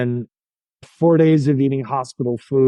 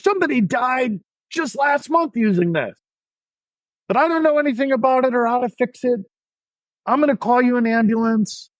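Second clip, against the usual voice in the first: much higher, agitated shouting; doctor going off; 11 semitones higher than usual. Retold or imitated. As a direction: blast the patient in a panicked rant. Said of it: "he's going off".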